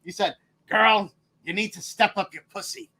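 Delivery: usual speaking voice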